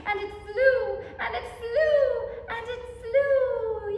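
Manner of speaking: high, loud, slowing wonder; rising joy. A narrator's reading. Reader cheering the paper airplane's flight, loud and slow.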